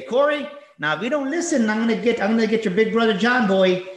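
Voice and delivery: put-on high, loud teacher's warning to a kid; scolding teacher. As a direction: threaten him like a stern teacher. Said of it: "teacher would say".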